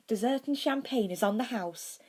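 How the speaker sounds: kindly offering a treat; warm. A narrator's reading. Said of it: "said in a warm voice".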